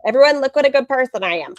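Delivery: high, loud mock boasting; mocking self-congratulation. Viewer mocking a vlogger's filmed good deed, sarcastic boast. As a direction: parade it sarcastically as a show-off. Mocks vlogger filming her own charity.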